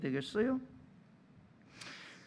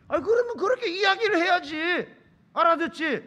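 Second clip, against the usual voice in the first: high, loud scolding comeback; exasperated argument; 9 semitones higher, 9 dB louder than usual. Acted. Boyfriend telling her to say it that way, exasperated shouting. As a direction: argue back at full volume. Acted lovers' quarrel.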